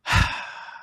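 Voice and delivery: long breathy sigh; heavy breath out after a long list; deep exhale. Real, not acted. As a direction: let out a big breath.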